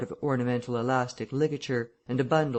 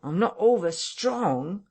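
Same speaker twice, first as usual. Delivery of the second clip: higher and more insistent; whining, louder repeat; 6 semitones higher, 3 dB louder, slower than usual. A narrator's reading. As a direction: press the complaint harder. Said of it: "he repeated".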